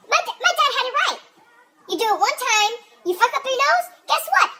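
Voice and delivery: high, rising worked-up voice; building up to the punchline; worked-up rant. Real, not acted.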